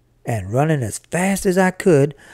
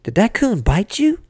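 worried mother; 6 semitones higher than usual. Acted. Worried mother checks for bites.

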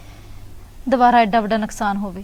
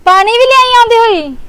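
loud call from the next room, much higher; 12 semitones higher, 11 dB louder than usual. Real, not acted. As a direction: shout it across the house. Calling out from another room.

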